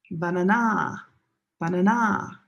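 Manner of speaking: "Banana" stressed on a long last syllable, ba-na-NAH; wrong final stress. Shown as a demonstration. Teacher showing students a non-word, "Banana" end-stressed as bana-NAH. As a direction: land hard on the end of "Banana", ban-a-NAH. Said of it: "nobody understands you".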